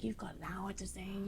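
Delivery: hushed whisper from the sound engineer. Retold or imitated. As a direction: whisper it like a bad-news aside. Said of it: "He's like".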